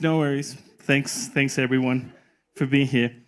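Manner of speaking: usual speaking voice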